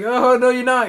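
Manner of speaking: playful mock shout; mock Big Show retort. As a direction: snap back like a cocky wrestler. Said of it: "Big Show's like".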